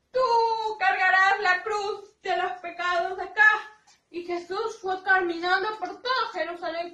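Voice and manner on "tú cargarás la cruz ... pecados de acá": loud, higher-pitched order; stern command. A narrator's reading. Bad people ordering Jesus to carry the cross, stern and loud. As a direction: pronounce a sentence in a raised voice.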